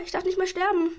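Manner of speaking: normal talking voice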